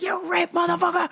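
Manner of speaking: high, strained mock yell; pissed. Man cursing the pump while smashing it, high mock fury. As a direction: curse in a squeezed high retold fury. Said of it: "I was pissed".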